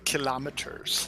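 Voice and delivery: low, soft "Kilometers" as kuh-LOM-eters; understated "Kilometers", AH in the middle; kilometers stressed on LOM. Shown as a demonstration. Echoing the other pronunciation.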